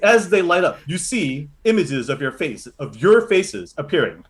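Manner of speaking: ordinary talk, nothing marked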